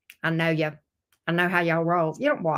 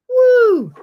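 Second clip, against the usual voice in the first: loud woo; 12 semitones higher, 10 dB louder than usual. Real, not acted. Exclaims at the reading.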